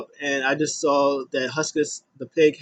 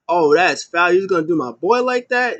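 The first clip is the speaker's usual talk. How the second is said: loud, raised protest; mock indignation; 4 semitones higher, 5 dB louder than usual. Fan protesting his favorite being cut off, mock-outraged and higher. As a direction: object on your friend's behalf, playfully outraged. His reaction to music cutting winner off.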